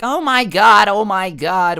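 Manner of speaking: high, loud mock panic; freaking-out mom. Mom seeing a grown man attack her son, freaking out. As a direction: imitate a mother's alarmed outcry. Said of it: "she starts freaking out".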